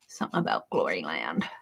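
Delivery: soft breathy whisper to herself. Real, not acted. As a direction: murmur the title under her breath. Humming to herself about a hymn.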